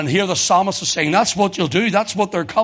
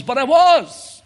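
higher, slow, insistent reply; Robert's protest; 10 semitones higher, 7 dB louder, slower than usual. Retold or imitated. Robert protesting to the preacher, drawn-out and higher. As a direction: stretch it out like a hurt excuse.

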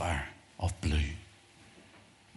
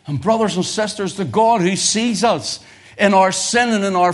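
full-force preaching; 12 semitones higher, 11 dB louder than usual. Acted. Preacher tells the congregation God still loves them, full force.

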